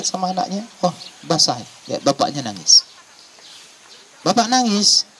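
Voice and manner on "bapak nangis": much higher, startled son's question; surprised question. Acted. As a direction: ask it high, in acted surprise. Son finds his father crying.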